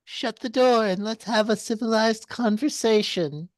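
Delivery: high, put-on voice from hiding; high-pitched covert request; hidden, hushed character voice. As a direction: speak up secretively from under the bed. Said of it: "very quietly from under the bed".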